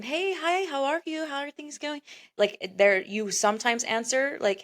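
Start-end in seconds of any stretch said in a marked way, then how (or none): 0.0-2.0 higher, bubbly "Hey, hi, how are you?"; overly friendly welcome; cheerful greeting. Retold or imitated. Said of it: "instead of being".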